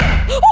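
sharp high gasp of fright; startled scream. Real, not acted. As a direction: jump and gasp in shock. Startled by the gavel bang.

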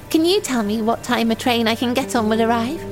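ordinary talk

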